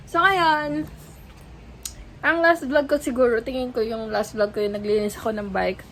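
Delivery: usual speaking voice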